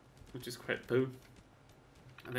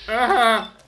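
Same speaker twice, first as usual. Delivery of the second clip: sharp high yelp of fright; startled scream; 9 semitones higher, 16 dB louder than usual. Real, not acted. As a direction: jump and yelp. Startled by a monster.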